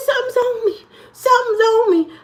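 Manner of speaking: mimicking his daughter's high frightened cry; frantic daughter. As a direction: squeal it like a scared kid. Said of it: "She said".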